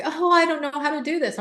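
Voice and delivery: worried client quote. Retold or imitated.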